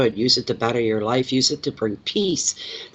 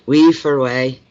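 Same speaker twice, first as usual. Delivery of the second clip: Scottish phrase; 6 dB louder than usual. Retold or imitated. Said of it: "almost like a different language".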